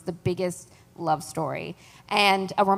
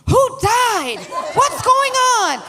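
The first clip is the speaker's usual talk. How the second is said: high, loud alarmed calls from another room; 13 semitones higher, 5 dB louder, slower than usual. Retold or imitated. Zoraida hearing screams, calling out alarmed. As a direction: shout in alarm from the next room. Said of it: "She was like".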